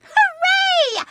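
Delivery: high, drawn-out hooray; triumphant cheer. Acted. Girl celebrates that her potions worked, triumphant cheer. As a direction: cheer in delight, voice leaping up.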